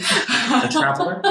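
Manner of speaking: high, slow, grand reveal of the title; dramatic title announcement. Acted. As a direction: announce it like a movie trailer.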